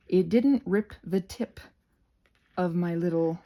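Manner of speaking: normal talking voice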